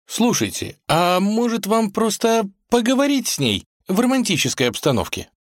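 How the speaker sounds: very high, meek little voice; timid suggestion. A narrator's reading. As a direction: offer an idea shyly. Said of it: "робко предложил Порфирий".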